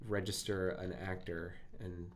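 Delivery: ordinary delivery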